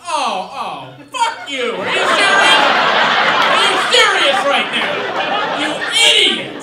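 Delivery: hammed-up shrieking tirade at a boyfriend; furious girlfriend. Girlfriend rained on in rowboat yells at him, furious. Imagines his lady's furious reaction.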